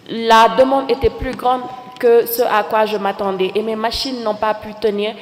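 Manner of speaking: normal talking voice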